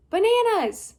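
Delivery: high, loud playful shout; silly yell. Acted. Trapped girl shouting nonsense, high loud yell.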